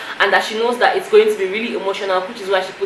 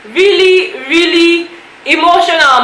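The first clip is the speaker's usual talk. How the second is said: overwhelmed; 8 semitones higher, 5 dB louder than usual. Real, not acted. Vlogger telling viewers how moving the show was, overwhelmed and gushing. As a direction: voice leaps higher and louder on really.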